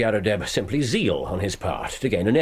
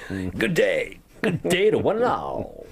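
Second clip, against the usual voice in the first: mocking the visitor's grand farewell in a higher voice; mocking echo; 4 semitones higher, slower than usual. Holmes mimics the departing client with amused mockery. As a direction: send it up, amused.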